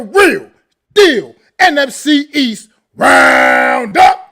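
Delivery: slow loud booming show intro; hype announcer. Real, not acted. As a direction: belt the show title like a host. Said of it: "So much better than me".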